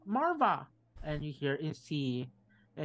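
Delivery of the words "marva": mild mock shout calling the wife's name, higher. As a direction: call across the house, higher. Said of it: "you just hear her shout".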